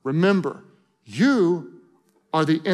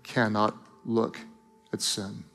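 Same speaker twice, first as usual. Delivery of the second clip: tender and hushed; 2 semitones lower than usual. Acted. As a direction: speak like a father breaking hard news gently. Tender God speaking to Jesus.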